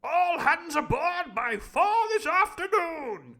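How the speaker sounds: booming high slow call; shout. Acted. The Squire shouting after Long John, high and slow. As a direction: bellow it after him. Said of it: "shouted the Squire after him".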